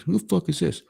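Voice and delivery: high, aggressive mimicked challenge; imitated angry demand. Frat guy to unknown caller, imitated hostile demand. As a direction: act the hostile guy taking over the call.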